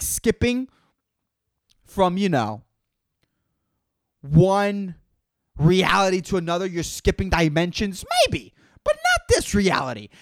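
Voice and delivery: ordinary talk, nothing marked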